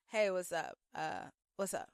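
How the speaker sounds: mock the fake cop's lazy greeting; a hint of a bored scammer playing police; fake police officer. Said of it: "He goes".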